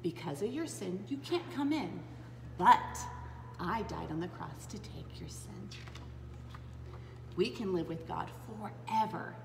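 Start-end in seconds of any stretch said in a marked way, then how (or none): none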